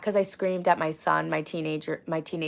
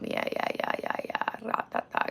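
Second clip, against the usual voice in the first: muttered under breath; 4 semitones higher, 7 dB quieter than usual. Real, not acted. Muttering while reading chat.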